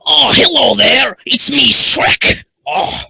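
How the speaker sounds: loud, high-pitched ogre impression; hammed-up, shouted ogre greeting; Shrek. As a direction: bellow it out as a big cartoon ogre. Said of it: "Shrek voice".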